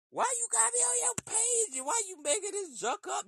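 high-pitched indignant questioning; indignant confusion. Retold or imitated. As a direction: demand answers in a squeaky, baffled outrage. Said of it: "you looking at the page like".

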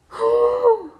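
high, loud exasperated sigh; loud dramatic sigh. Real, not acted. Podcaster heaving a big sigh after freaking out. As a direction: let out a huge theatrical sigh.

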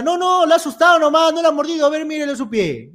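high, far-off neighbor voice brushing it off; dismissive neighbor. Retold or imitated. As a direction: shrug it off as the neighbor lady. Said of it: "y la vecina".

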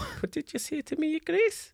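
put-on high little boy voice, soft; child voice. Thrapple turns on Grace, high child voice. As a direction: pipe up like a small kid.

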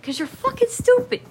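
loud, high-pitched retold outburst; mock exasperated. Sister retelling her comeback to brother, exasperated. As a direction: throw the insult back, exasperated.

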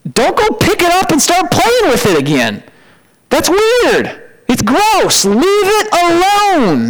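high-pitched, appalled command; disgusted warning. Acted. Preacher warning church off dead sin, disgusted warning. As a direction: plead and forbid at once, high and emphatic.

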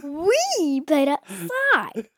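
very slow, high, soft dad impression; imitating dad. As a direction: drag it out like a preachy parent. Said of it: "Is, is that what I sound like?".

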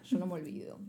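teasing her friend with a fake vendetta; mock menacing. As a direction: pretend to hold a grudge ominously. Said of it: "no, mentira".

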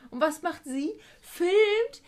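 normal talking voice

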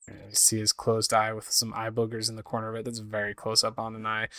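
ordinary talk, nothing marked

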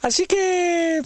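stretched up high, held long; drawn-out comic cue; high sustained so. Acted. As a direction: hold the word way up.

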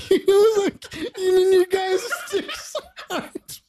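high, squeaky laughing words; squeezing words through helpless laughter; stifled giggles. Real, not acted. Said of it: "I can't fucking breathe".

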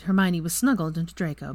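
ordinary delivery

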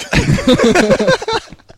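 squealing laugh; high burst of laughter. Real, not acted. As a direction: crack up high. Laughs at joke.

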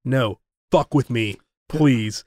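low, slow retold dare; mock tough guard calling the bluff; badass comeback. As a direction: drop low and take your time, daring him.

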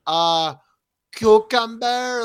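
mock fake Spanish accent, slow, rising high and loud on cucumber; a put-on Spanish accent, drawn out; hammed-up fake Spanish accent, searching for the word. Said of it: "when Hilaria Baldwin was just like".

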